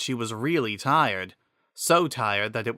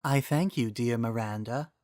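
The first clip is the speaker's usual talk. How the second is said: softer, gentle and grateful; a wise spirit speaking kindly; the Triforce of Wisdom thanking Miranda, gentle and soft; soft female voice; 5 dB quieter than usual. Acted. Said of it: "it said in a soft female voice".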